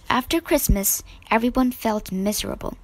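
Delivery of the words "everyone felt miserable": plain reading voice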